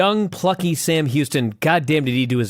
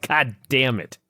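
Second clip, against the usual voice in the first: low, soft grudging curse; resentful cursing; 7 semitones lower, 5 dB quieter than usual. Acted. Imagined sore loser cursing a rival who was right, resentful. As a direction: curse under your breath, mock bitter.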